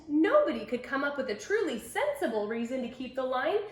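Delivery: ordinary delivery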